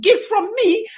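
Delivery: high pleading voice, mock begging; hammed-up plea to take a gift; begging friend. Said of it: "let me take for example".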